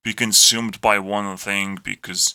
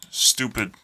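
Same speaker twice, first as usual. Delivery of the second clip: ordinary talk, nothing marked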